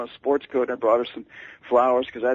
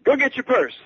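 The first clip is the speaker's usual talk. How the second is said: high, loud, brusque demand; high-pitched, loud impersonation of a famous singer on the phone; 11 semitones higher than usual. Retold or imitated. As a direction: bark it down the phone like a star. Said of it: "shouting so loudly".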